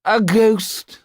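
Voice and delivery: high, loud bleary shooing; a drunk marquis banishing a ghost; drunken dismissal. A narrator's reading. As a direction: wave off the ghost, drunk and haughty.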